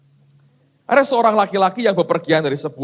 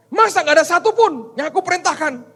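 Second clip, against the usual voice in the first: indignant God voice; 12 semitones higher, 5 dB louder than usual. Acted.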